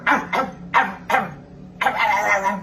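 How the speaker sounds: deep growling barks; scary dog bark demo. Shown as a demonstration. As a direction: bark to frighten. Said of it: "di ba natakot ka?". (nakakatakot na tahol ng aso)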